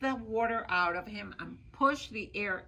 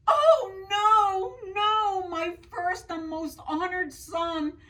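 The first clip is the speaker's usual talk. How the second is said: high, loud, distraught cry of a mother; alarmed mother; 8 semitones higher, 7 dB louder than usual. Acted. The mother hears her eldest son is in the well and cries out in alarm. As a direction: wail it out, panicking for her son.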